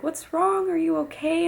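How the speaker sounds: mock-worried high voice asking if she's okay; worried girls. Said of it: "all the girls are like".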